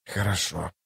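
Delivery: soft, low grumble; grudging grumble. A narrator's reading. Toc giving in as he enters the room, grumbling. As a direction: give in with a quiet grumble. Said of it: "проворчал он, входя в комнату".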